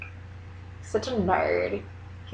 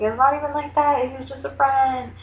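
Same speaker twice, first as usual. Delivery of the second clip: defensive protest; 5 semitones higher, 3 dB louder than usual. Retold or imitated. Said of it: "I'm like".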